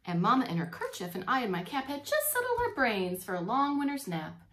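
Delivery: put-on lively read-aloud for children; reading the poem to the class, with expression; storybook reading. Teacher reading Christmas poem to kids, animated storytelling.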